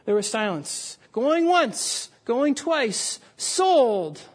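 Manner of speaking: brisk mock auction call; auctioneer calling. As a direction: call out the bids and close the sale. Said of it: "The auctioneer said".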